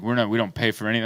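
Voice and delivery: low, soft and fast, a lightly voiced old-school mom; stern frugal mom. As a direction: mutter it like a thrifty parent.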